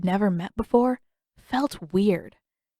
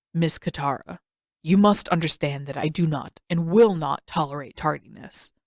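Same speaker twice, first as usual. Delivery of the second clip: a hint of a lower, stern old man; lightly voiced stern old master; 4 semitones lower than usual. Old Pakku lecturing Katara about tardiness, lightly stern. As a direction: scold her for being late, low and stiff.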